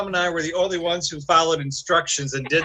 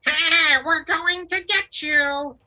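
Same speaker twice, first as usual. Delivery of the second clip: high, slow witchy hag; 11 semitones higher, slower than usual. Retold or imitated. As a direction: cackle it like a hag. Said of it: "the hag says".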